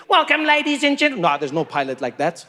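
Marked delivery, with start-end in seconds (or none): 0.1-1.2 high sing-song cabin crew welcome; lilting flight attendant voice. Retold or imitated. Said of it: "they do that thing".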